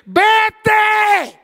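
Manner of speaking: booming high shout; God shouting. Acted. God shouting at the stubborn believer, booming. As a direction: roar the single word. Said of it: "Y Dios gritándote".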